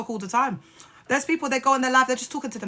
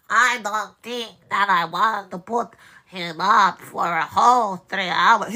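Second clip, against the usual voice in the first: slow sarcastic drawl; 4 dB louder, slower than usual. Acted. Mocking listening to Dag.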